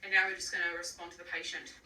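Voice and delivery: ordinary delivery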